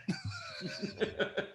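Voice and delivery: high unvoiced laugh; real laughter; breathy laughing fit. Real, not acted. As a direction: laugh out hard. Laughing after his joke.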